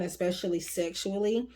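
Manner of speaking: normal talking voice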